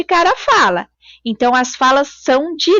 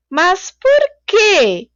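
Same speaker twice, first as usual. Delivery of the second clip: slow, higher "Mas por quê?" as a drawn-out question; puzzled salesman's slow question; 7 semitones higher, much slower than usual. Shown as a demonstration. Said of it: "Ponto de interrogação".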